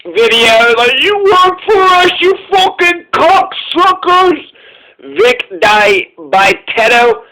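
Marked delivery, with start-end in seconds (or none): 1.0-4.4 high-pitched mock yell, slow; hammed-up imitation of a yelling boss; imitated yelling. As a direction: scream it at the video crew. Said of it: "He's always yelling".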